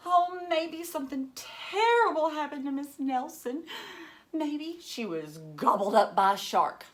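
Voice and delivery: put-on high, loud kid voice fretting; worried kid. One kid guesses about missing teacher, high and worried. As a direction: play a fretful child imagining the worst. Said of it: "said one of the kids".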